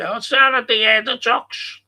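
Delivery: imitating a confident local getting it wrong; mimicking people. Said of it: "People say".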